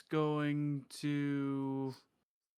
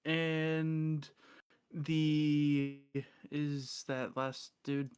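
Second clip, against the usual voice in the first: normal talking voice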